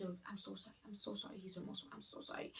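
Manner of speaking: soft, near-breathless mocking apology; lightly imitating him begging under his breath; mock pleading hush. Imitating boyfriend on his knees begging forgiveness, soft mocking hush.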